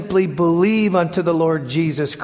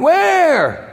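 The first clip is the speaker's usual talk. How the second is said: high, loud startled cry; startled question; 12 semitones higher, 4 dB louder than usual. Retold or imitated. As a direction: jolt awake and ask. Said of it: "they all went".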